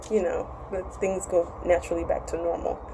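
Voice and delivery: ordinary delivery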